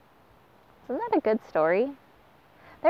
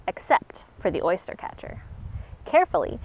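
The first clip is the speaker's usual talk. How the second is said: usual speaking voice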